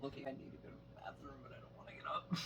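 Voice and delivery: soft unvoiced whisper. Real, not acted. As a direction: whisper it so the mic barely hears. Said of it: "whispering during recording".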